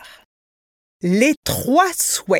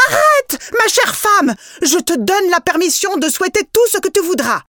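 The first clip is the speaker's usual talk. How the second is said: very high, loud cry to stop her; shouted plea; 8 semitones higher, 4 dB louder than usual. A narrator's reading. Husband crying out to his wife at the window, loud and high. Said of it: "qui l'aimait, cria".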